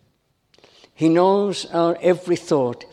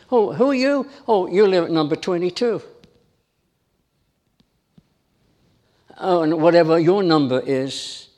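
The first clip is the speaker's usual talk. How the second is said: full-force preaching. Real, not acted. Preacher rousing the congregation about labels, impassioned. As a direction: drive the point home to the congregation.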